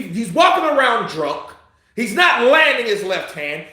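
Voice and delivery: usual speaking voice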